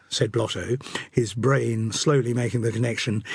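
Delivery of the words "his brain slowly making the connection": ordinary delivery, nothing marked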